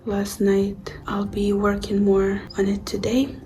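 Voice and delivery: soft, sleepy, just-woke-up voice; half-whispered and sleepy, barely awake. Real, not acted. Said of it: "Excuse my voice, I'm still sleepy".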